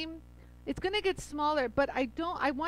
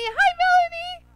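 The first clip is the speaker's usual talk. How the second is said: much higher, louder singsong greeting; sing-song hello; 10 dB louder than usual. Real, not acted. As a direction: croon her name happily. Greeting a viewer twice.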